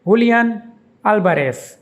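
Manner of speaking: Julian as hoo-lee-AHN, Alvarez as AL-ba-res; Spanish pronunciation. Shown as a demonstration. Teacher demonstrates the footballer's name, saying it the Spanish way. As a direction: Julian with an h sound and stress on the last syllable, Alvarez with a b for v and an s ending. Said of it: "is pronounced".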